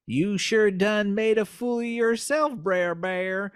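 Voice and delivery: slow, high Southern drawl; sly Southern fox voice; Southern fox twang. Acted. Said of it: "twang for the voice of the fox".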